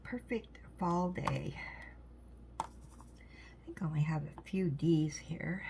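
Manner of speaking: soft whisper while working. Real, not acted. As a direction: hush it like a quiet ASMR chat. Whispers while crafting on stream.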